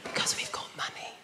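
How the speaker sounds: unvoiced hushed whisper. Shown as a demonstration. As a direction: whisper it like a posh low talker. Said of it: "low talkers".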